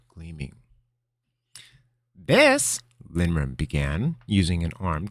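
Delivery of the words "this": put-on grand old wizard. Linram presents the room grandly.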